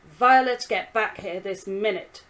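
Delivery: a stern father's loud command; terrifying tone. Acted. Father orders Violet back into the kitchen, loud and stern. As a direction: bark her name, then order her back. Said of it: "His tone was terrifying".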